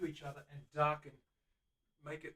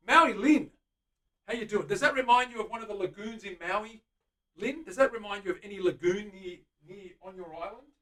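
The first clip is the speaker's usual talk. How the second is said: high, loud call to a friend; excited greeting; 5 semitones higher, 13 dB louder than usual. Real, not acted. Painter greeting viewer Lynn from Maui, excited. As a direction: call out to her cheerfully.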